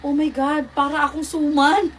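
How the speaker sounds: real laughing disbelief; laughing exclamation. Real, not acted. Laughs at how she looks.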